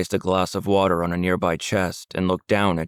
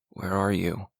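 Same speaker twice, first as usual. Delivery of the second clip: soft, quiet mumble under the breath; mumbled; 5 dB quieter than usual. A narrator's reading. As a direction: mumble it to yourself, barely audible. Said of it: "he mumbled".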